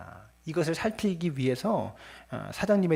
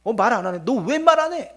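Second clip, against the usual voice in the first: angry parent; 2 semitones higher, 4 dB louder than usual. Retold or imitated. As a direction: press the child, then blow up at them. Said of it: "그러면서 막 이렇게 화를 내서는".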